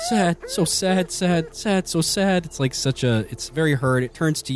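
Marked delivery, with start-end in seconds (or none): 0.1-2.5 high, slow doggy whimper; pitiful high whine with drawn-out words. Acted. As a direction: whimper it like a sad dog. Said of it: "it's, like, whimpering".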